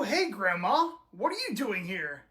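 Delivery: normal talking voice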